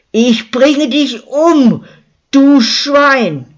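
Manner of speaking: high, loud and slow furious roar; bellowing, beside herself. A narrator's reading. Luzi beats the attacker in the street: furious high roar. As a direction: roar it out in blind rage.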